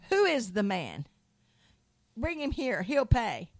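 loud, furious outburst; angry demand. Retold or imitated. King David, enraged by the prophet's tale, loud furious demand. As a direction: demand the culprit in a rage.

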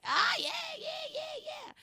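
mock the girls gushing over Magic Mike; giddy squeal. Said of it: "it was like".

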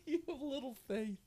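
dote on Peter while laughing; proud laughing parent. Retold or imitated. Preacher voicing Jesus hugging Peter like a proud parent. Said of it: "like a proud parent".

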